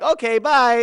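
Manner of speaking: imitating small kids' quick agreement; six-year-old kids. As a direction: chirp it like oblivious little children. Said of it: "bunch of six-year-old little boys and girls".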